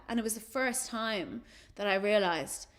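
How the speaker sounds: ordinary talk, nothing marked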